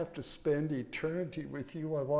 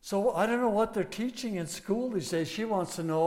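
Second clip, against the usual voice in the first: ordinary talk, nothing marked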